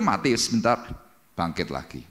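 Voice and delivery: usual speaking voice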